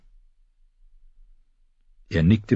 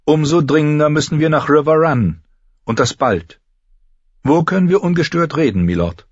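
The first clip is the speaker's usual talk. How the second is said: a higher, urgent noblewoman; Caitlin's voice; 10 semitones higher, 5 dB louder than usual. Acted. Caitlin urging Lord Frey to talk, tense noblewoman voice.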